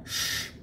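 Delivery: long soft sh hiss, smiling; hiss like a kitten. Shown as a demonstration. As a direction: hiss sh like a little cat. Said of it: "temos esse som".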